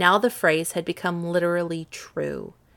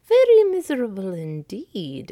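louder mock exclamation; the stranger exclaims back, louder; exclaiming stranger; 3 semitones higher, 7 dB louder than usual. Stranger echoing the king, exclaiming. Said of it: "exclaimed the stranger".

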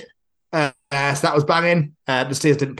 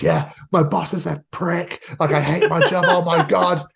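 joking boss; 6 semitones higher than usual. Retold or imitated. Retelling the boss's prank call, higher and laughing. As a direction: play the boss mocking himself. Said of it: "He goes".

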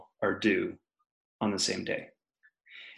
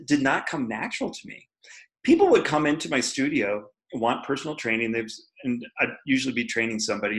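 ordinary talk

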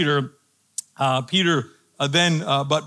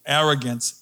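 ordinary talk

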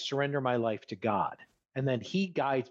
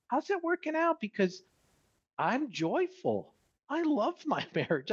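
high, pointed sarcastic question; 9 semitones higher than usual. Retold or imitated. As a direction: needle them, pitched way up. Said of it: "it sounds like I'm being sarcastic".

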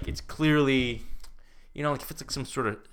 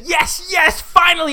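hammed-up high, loud cry of liberation; triumphant release; 10 dB louder, slower than usual. Woman freed from a painting before she sprints off, exultant. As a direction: shout it high like someone freed at last.

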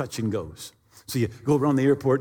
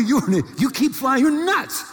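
high, rising mock rant; incredulous outburst; 11 semitones higher than usual. Pilot tells the owner he's nuts, high-pitched exasperation. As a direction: climb higher with each jab, half laughing.

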